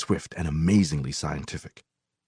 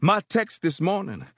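shouting preacher; 10 semitones higher than usual. A narrator's reading. Preacher addressing brothers and sisters, shouting. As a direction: announce the text like a preacher. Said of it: "the preacher shouted".

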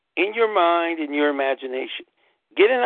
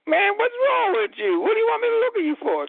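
much higher, fast mocking girlfriend voice; squeaky skeptical partner impression; mimicking girlfriend; faster than usual. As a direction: play her as baffled and dismissive. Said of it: "looking at me like".

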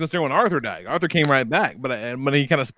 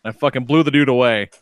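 usual speaking voice

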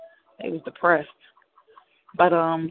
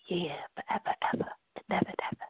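breathy whisper of the hook, much softer; whispered echo; 12 dB quieter, faster than usual. Acted. DJ echoing the song, whispering softly. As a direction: fade the words into a whisper.

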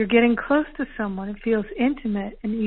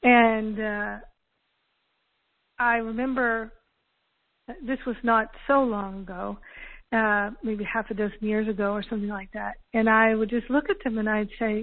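ordinary talk

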